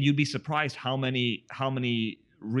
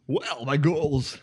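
radio voice; 5 semitones higher, 6 dB louder, much slower than usual. Pretend. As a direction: stretch it out like a DJ on air. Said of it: "in your radio voice, please".

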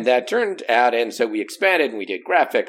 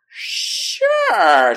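eager yes; 14 semitones higher, 6 dB louder than usual. Retold or imitated. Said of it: "And I went".

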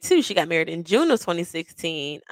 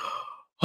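frightened gasp; 20 dB quieter than usual. Retold or imitated. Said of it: "the scaredest I've ever been".

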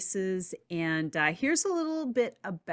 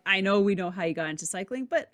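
usual speaking voice

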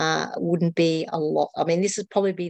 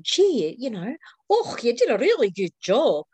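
higher-pitched imitation of Scottish relatives; Scottish accent; 4 semitones higher than usual. Guest quoting her cousins' praise, in a Scottish accent. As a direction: put on a lively Scottish accent. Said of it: "my cousins over there said".

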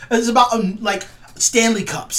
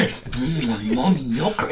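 low, sleazy, lustful murmur; creepy leer; 9 semitones lower, 6 dB quieter, much slower than usual. Retold or imitated. Mocking creepy online fans, low leering murmur. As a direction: ooze it like a creep. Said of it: "In a weird".

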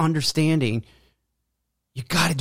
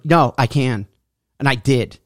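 ordinary delivery

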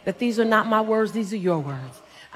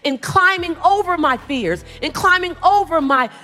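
raised, driving motivational cadence; impassioned preaching; 4 semitones higher, 5 dB louder than usual. Real, not acted. Speaker urging the audience to climb over fears, impassioned preaching. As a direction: build each repeated phrase like a preacher.

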